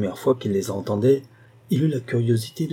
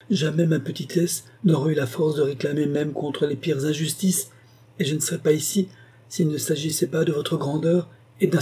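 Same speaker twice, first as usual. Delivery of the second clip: higher, humble pleading voice; a young girl begging respectfully; humble girl's voice; 5 semitones higher than usual. Acted.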